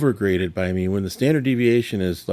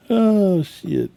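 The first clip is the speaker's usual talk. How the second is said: surprised; 9 semitones higher than usual. Real, not acted. Sudden surprise.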